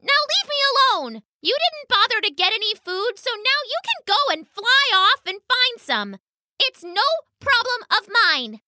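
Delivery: high, indignant little mouse; defiant mouse. Acted. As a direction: send the bird off in a squeaky high voice. Mouse sends dove away.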